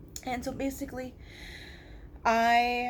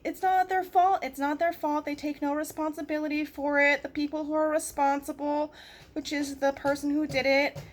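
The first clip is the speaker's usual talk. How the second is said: bitter sarcasm; 6 semitones higher, 3 dB louder than usual. Real, not acted.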